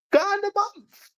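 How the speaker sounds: very high, slow squeaky mock voice; ridiculing falsetto; mocking squeak. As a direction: squeal it in disbelief. Mocking Nonceleg's income.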